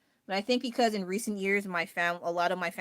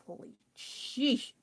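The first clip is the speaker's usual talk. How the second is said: awed whisper; 4 semitones higher, 5 dB quieter, much slower than usual. Real, not acted. Stunned by twelve kids.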